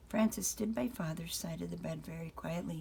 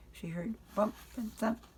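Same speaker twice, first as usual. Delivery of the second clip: ordinary delivery, nothing marked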